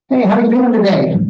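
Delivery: high, loud, breezy "how are you doing today"; mock cheery greeting. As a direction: play the clueless upbeat visitor. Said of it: "What a dumb thing to say".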